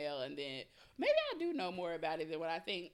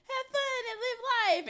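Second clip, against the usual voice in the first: much higher, singsong voice that almost cries; mock carefree voice; 5 dB louder than usual. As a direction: play the free-spirited Cancer, way up high. Mocking how Cancers talk.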